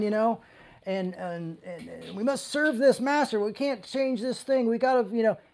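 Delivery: ordinary talk, nothing marked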